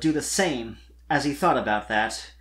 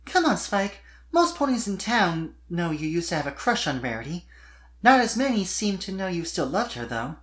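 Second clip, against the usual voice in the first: much higher giggly filly voice; Sweetie Belle voiced; 5 semitones higher than usual. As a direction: play a bright young unicorn filly, teasing kindly.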